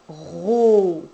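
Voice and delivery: "ro" loud and drawn out, R held before a long O; insisted syllable sound. Shown as a demonstration. Dictating the next syllable to a child, "ro" insistently stretched. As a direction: stretch both sounds of "ro", swelling on the O. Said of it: "n'hésitez pas à insister".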